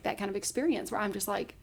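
ordinary talk, nothing marked

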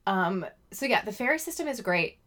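ordinary talk

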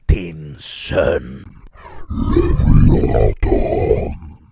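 killer revealing himself as her son; menacing dark figure. A narrator's reading. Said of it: "sa skikkelsen".

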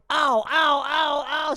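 high, loud yelps of pain; pained ow cries. Acted. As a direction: cry out as you get hit. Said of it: "said Kim Jong-un".

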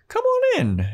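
very high sing-out mom voice; hammed-up, high-pitched welcome at the door; hostess invitation. Joyce invites them in.

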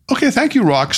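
half-hearted thanks, high start dropping away; mildly reluctant, unconvinced; without conviction. A narrator's reading. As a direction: go through the motions of thanking. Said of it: "without much conviction at all".

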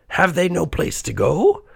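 high, concerned character voice; Scrooge. A narrator's reading. Old miser asks the ghost about two unhappy children, high questioning voice. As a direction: pitch it high, lifting into a question. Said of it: "said Scrooge".

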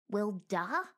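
puzzled "Well, duh?" rising at the end; incredulous echo. A narrator's reading. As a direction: repeat it back, offended. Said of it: "What do you mean by that".

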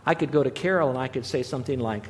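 ordinary delivery

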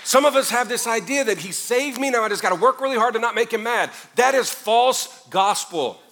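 loud, high, impassioned sermon climb; full force preaching. Acted. Pastor to congregation on peace with God, impassioned preaching. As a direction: drive it home to the back row.

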